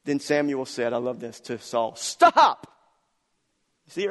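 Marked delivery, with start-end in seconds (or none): 2.0-2.7 a much higher, louder bark of Stop; sharp shout of Stop. Acted. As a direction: cut him off with a loud command. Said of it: "That's how he said it".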